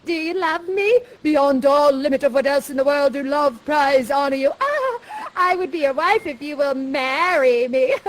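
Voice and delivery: swooning, hammed-up romantic declaration with a jump up on ah; hammed-up lovers. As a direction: overplay the lovestruck suitor. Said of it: "Ferdinand says".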